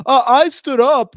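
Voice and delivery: high, loud, slow mockery; mock boasting. Person wanting social currency, mockingly boastful. Mocking someone doing a victory lap.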